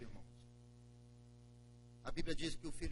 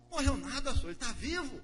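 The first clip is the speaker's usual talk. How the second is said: higher and louder, insisting; eager servant; 9 semitones higher, 6 dB louder than usual. Acted. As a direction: assure him the brother lives. Knecht antwoordt.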